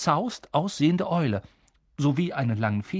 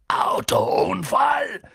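very slow, louder, breathy roar; thundering; 5 semitones higher, 5 dB louder, much slower than usual. A narrator's reading. Hagrid thunders at the Dursleys' lie. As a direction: explode in outrage. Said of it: "donnerte Hagrid".

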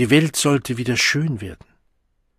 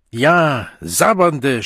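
loud, raised insult; angry shout; 4 semitones higher, 6 dB louder, much slower than usual. A narrator's reading. The Savage insults the staring Deltas, shouting. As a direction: shout it at them in hatred. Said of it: "schrie er".

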